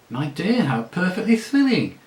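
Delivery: high, loud gushing thrill; gushing delight. Acted. La Saraline thrilled by her runaway friend, gushing. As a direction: squeal with delight at the gossip.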